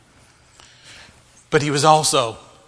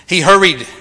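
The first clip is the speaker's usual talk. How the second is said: "hurried" punched out loud; stress on hurried; 3 semitones higher, 7 dB louder than usual. Shown as a demonstration. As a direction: hit the word "hurried" hard. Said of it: "Emphasize the word".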